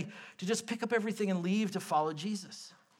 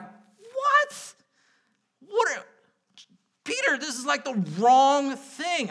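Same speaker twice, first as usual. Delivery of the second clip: high, louder disbelieving cry; incredulous; 7 semitones higher, 6 dB louder, slower than usual. Retold or imitated. Preacher reacting to Peter's words, high and incredulous. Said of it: "I read this and I'm like".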